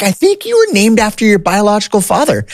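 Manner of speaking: high, light imitation of mom; a hint of mom breaking the news; voicing mom. Mom quoted revealing the father.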